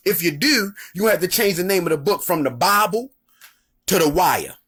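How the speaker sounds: normal talking voice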